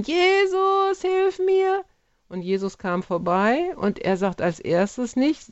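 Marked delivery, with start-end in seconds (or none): 0.0-1.8 retold cry for help. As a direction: cry out like a blind beggar at the roadside. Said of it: "hat geschrien".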